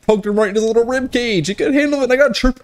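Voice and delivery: high-pitched silly crowing; playful squeaky boast; gleeful gloating. Acted. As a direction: crow it up in falsetto.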